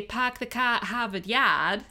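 light Boston accent with dropped Rs; a Bostonian saying it, Rs dropped; Boston accent, car said kah. Shown as a demonstration. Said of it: "So whereas a Bostonian might say".

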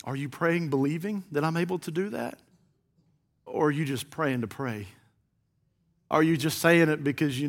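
low, very soft, searching questions; still small voice. Acted. God to someone praying in the closet, still small voice. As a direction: speak softly into the listener's heart. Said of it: "that still small voice comes across".

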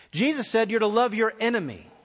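normal talking voice

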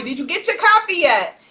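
normal talking voice

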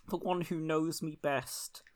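whispered politician impression; Keir Starmer. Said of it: "That's not a good Keir impression".

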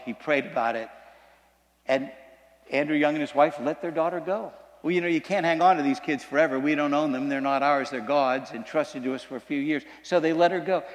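usual speaking voice

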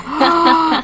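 loud, high gasp of surprise; re-enacted gasp of astonishment; retold shocked gasp. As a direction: gasp as if just seeing big news. Retelling her reaction to message.

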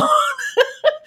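short high giggle; short high laugh. Real, not acted. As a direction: laugh for real. Laughing at own story.

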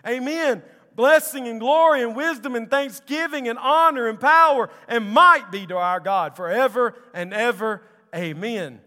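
very loud, high, ringing praise; exultant proclamation. Acted. Angels worshiping God before the throne, exultant shout. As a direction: proclaim it at full force, drawing out the first Amen.

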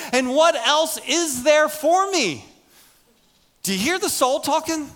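loud, high, driving preacher voice; fired-up sermon delivery; full-force preaching. Acted. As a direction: preach it hard to the back row.

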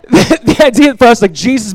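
laughing. Real, not acted. Laughing after story.

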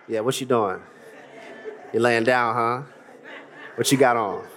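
low seductive murmur; imitating a guy flirting at night; late-night smooth guy. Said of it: "He wasn't talking like that at two P M".

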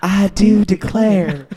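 slow, high Southern belle drawl; hammed-up Southern "I do declare". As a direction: imitate Pam's Southern belle. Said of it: "Pam's like".

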